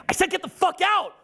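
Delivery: high-pitched mock yell; imitated angry insistence. Son repeats his demand to laughing dad, imitated angry yell. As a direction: act out a teen who will not be ignored.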